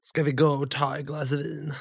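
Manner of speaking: a Söder guy offering wine; Söder Stockholm accent. Retold or imitated. Co-host mocks a Stockholm accent, lightly. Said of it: "söderstockholmska".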